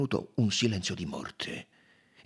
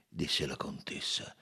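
ordinary delivery

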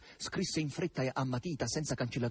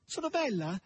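hoarse call, pitched higher and a bit louder; a hoarse, thrilled shout; loud hoarse cry. A narrator's reading. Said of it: "gridò forte con voce arrochita".

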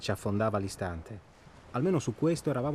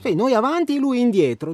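heated emphasis; 11 semitones higher, 9 dB louder than usual. Acted. Emphatic frustrated explanation.

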